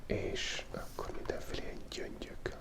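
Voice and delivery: normal talking voice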